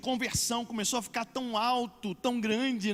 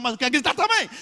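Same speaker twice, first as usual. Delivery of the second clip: briefly raised voice, imitating a crowd member; mild mock yell; 4 semitones higher, 7 dB louder, faster than usual. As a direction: join the shouting half in jest. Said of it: "Um começa a gritar". (imitando grito da multidão, leve)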